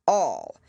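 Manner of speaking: All hit hard and loud; great emphasis. A narrator's reading. King glaring at Alice, stressing All. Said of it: "he repeated with great emphasis".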